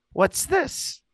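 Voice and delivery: put-on high, questioning little-girl voice; toddler voice. Uncle imitating his niece asking about a toy, high toddler voice. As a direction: mimic a two-year-old holding up a toy. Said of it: "and she goes".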